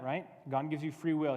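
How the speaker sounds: usual speaking voice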